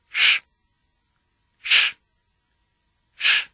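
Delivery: Sh made with the tongue tip curled up; Klingon sh. Shown as a demonstration. Teacher leads repetition, Sh said with tongue curled back. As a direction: separate hissed Sh sounds, tongue on the hard palate. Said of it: "put your tongue in the same place".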